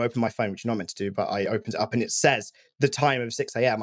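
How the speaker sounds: ordinary delivery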